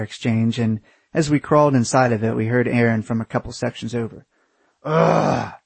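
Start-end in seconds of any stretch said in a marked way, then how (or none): 4.8-5.5 exasperated, higher-pitched groan; loud sigh. A narrator's reading. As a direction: let out a loud "ugh" of annoyance. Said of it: "He sighed loudly".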